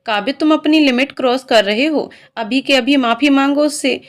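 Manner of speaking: plain reading voice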